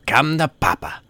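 high-pitched, gambler's holler; gleeful yell. A narrator's reading. Gambler atop his chair hollering for luck, excited yell.

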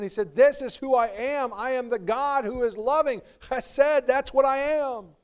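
loud, high preaching on God's love; fervent preaching. Acted. Preacher proclaiming God's covenant love, loud and fervent. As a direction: preach it with rising fire.

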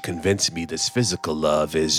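erotic weight. Acted. Yulin bargains with narrator over decorations, low and seductive. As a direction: let the words hang heavy with desire. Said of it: "a heavy erotic weight in his tone".

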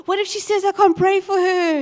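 playful anxious rush; mock worry. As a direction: fret out loud in jest. Anxious self-talk retold.

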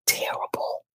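breathy whispered verdict. Real, not acted. Co-host whispering her verdict on the show, hushed. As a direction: whisper the word like a final sigh.

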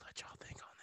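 teasing stage whisper. Acted. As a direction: let the punchline hang in a whisper. Whispered punchline.

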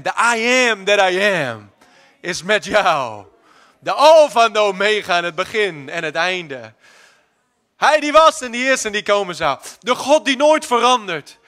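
building to a loud, slow proclamation; fiery preaching. Acted. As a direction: thunder the promise over the congregation. Preacher at full force.

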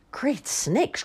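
high croaked exclamation; lightly voiced croaky old man, high; croaking old man. A narrator's reading. Old man reacts to the theft, lightly croaked exclamation. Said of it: "croaked the old man".

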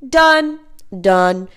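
stamp each done down, peaking loud; building to emphatic shout. Shown as a demonstration. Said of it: "follow it up with".

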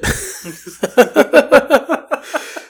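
a high-pitched burst of laughter. Real, not acted. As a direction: crack up at your own story. Laughing at the story.

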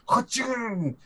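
high burst of mock monster noise; monster sound effect. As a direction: blurt a silly monster-attack sound. Demon bursting out in game.

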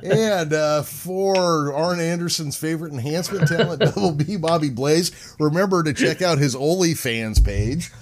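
high, giggling sign-off; can barely get it out for laughing. Real, not acted. Teasing sign-off, cracking up.

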